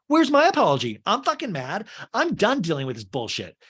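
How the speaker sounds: high, loud mock outrage; hammed-up fury at no apology; mock angry Lisa. Lisa in confessional demanding an apology, mock furious. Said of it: "Lisa in a confessional goes".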